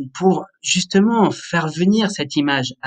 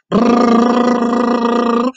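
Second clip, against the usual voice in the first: long high lip trill, getting faster; lip trill speeding up; 8 semitones higher, 6 dB louder than usual. Shown as a demonstration. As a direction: buzz the lips faster and faster. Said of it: "et vous allez de plus en plus vite".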